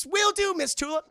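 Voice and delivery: high, loud little robot reply; cheery robot voice. Acted. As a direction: answer like an eager little robot. Robot One One answering cheerfully.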